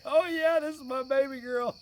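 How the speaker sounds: high, loud mock boast; proud teasing dad. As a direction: announce her to the whole store. Dad loudly claims embarrassed daughter.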